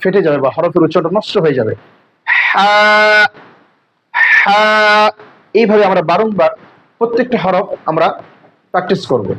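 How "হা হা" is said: হা said with continuous airflow from mid-throat; breathy Arabic ha. Shown as a demonstration. Teacher drilling the letter for students, airy হা. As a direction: keep the breath flowing on হা, mouth not wide. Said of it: "আমরা বেশি হা করে দেব না".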